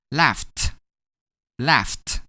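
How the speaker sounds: "laughed" in one syllable ending "ft". Shown as a demonstration. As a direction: finish "laughed" on a released voiceless t. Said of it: "we're gonna pronounce it as".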